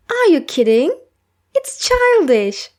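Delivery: mock-appalled teasing; incredulous scoff. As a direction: scoff at a friend's silly idea, pitch jumping up. Friend scoffs at cartoon movie.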